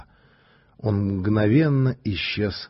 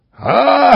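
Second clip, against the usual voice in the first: big thundering laugh, high and loud; a gloating strongman roaring with laughter; booming laugh; 9 dB louder, much faster than usual. Acted. Strongman laughing at his own joke, booming. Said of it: "загремел он".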